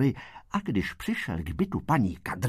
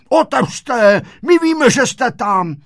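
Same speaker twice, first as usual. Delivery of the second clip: high, loud demand; shouting through door; 10 semitones higher, 10 dB louder, slower than usual. A narrator's reading. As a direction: yell at them to open up. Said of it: "a volají". (křičí přes dveře, vysoko a hlasitě)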